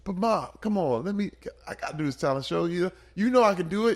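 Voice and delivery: high, eager pleading; begging kid. Retold or imitated. Teenage son begs his religious mother to let him dance, eager pleading.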